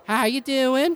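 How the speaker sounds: very high Southern belle voice; high, girlish Southern greeting; Southern blonde woman. Acted. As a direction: pitch it way up with a Southern accent. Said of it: "sort of a southern, uh, blonde woman".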